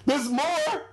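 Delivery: high, delighted disbelief; surprised old man. Retold or imitated. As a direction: perk up, the movie isn't over. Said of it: "He's like".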